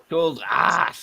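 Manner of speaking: mock Welsh lilt; Welsh accent. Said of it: "Didn't you get my Welsh accent?".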